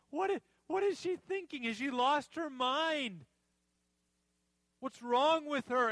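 high, incredulous acted outrage; indignant. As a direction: play an appalled onlooker scolding her. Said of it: "They spoke indignantly".

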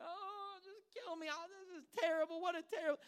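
high, melodramatic mock moan; pouting. Preacher mimicking prophet sulking under the tree, pouting moan. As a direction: moan it in exaggerated self-pity. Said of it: "he's pouting".

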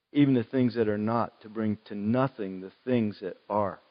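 scripture reading. Acted. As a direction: read the verses quietly and reverently. Reads Scripture passage.

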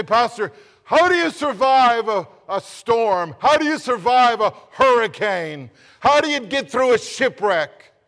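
loud, high pleading questions; mock desperate congregant. Congregant pleading with the pastor, loud mock desperation. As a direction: cry out to the pastor for help. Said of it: "You say".

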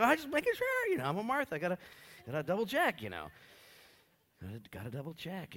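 high, loud anxious double-checker. Retold or imitated. Pastor voices himself nervously double-checking with his wife. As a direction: fuss over making sure. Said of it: "I was like".

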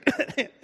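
put-on coughing fit; fake cough. Sarah covering her laugh before God, fake cough. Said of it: "This is coughing".

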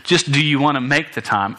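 ordinary delivery

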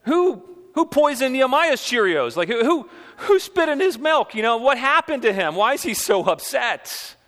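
high, incredulous voicing of the people; hammed-up disbelief at the governor's temper; mock bewildered crowd. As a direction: play the puzzled crowd, pitch up and baffled. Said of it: "their response is".

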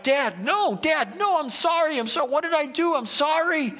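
very high, acted frantic plea; desperate begging. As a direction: act out a son begging his dad for his life. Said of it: "gotta be begging his dad for his life".

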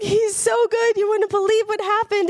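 high-pitched, overwhelmed joy; retold tearful gushing. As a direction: gush as if bursting to share amazing news. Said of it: "And I'm crying, and I'm shaking".

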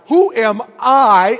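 Who am I with I punched loud and slow; stress on I. Acted. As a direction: hit the word I hard. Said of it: "emphasis on I".

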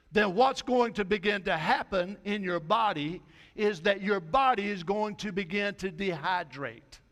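ordinary talk

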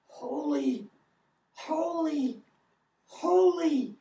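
rising worshipful proclamation; building holy cry. Acted. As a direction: swell the praise word by word. Quoting angels' cry, building.

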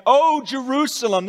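loud, high lament; weeping lament. Acted. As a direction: cry out over the city. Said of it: "this is Jesus weeping".

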